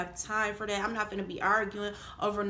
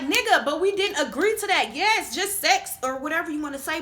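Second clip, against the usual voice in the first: loud, high indignant retort; heated, higher-pitched rebuttal; indignant arguing back; 6 semitones higher, 7 dB louder than usual. Retold or imitated. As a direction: fire back loudly in protest. Acted quarrel, arguing back.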